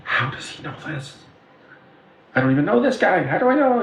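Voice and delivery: rising high, disbelieving mock panic; imitating shocked friends. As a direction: play the bewildered friend, pitch climbing. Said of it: "looking at her and looking at me like".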